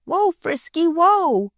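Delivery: yelling whoa. A narrator's reading. Boy dragged by the calf, yelling whoa high. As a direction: yell after the bolting calf. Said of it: "yelled Freddie".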